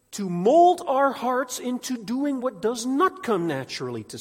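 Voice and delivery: high, loud impassioned preaching; preaching at full force. Acted. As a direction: preach it with rising fire.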